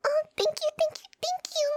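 bubbling over with joy; overjoyed. Acted. Overjoyed thanks for beach trip.

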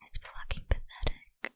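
usual speaking voice

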